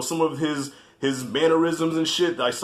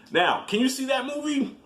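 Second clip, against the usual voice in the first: ordinary talk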